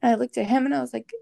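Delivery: usual speaking voice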